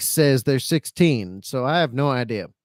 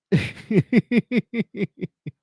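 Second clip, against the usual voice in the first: short laugh; 4 semitones higher than usual. Real, not acted. Laughs at his own story.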